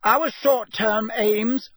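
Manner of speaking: high, loud, slow declaration. A narrator's reading. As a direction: talk over an objection. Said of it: "speaking even more loudly than Ron".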